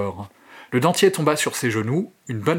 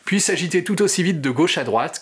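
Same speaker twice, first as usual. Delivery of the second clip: ordinary delivery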